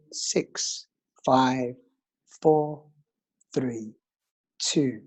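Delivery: calm counting breath guide; slow soothing breathwork instructor; guided meditation. Acted.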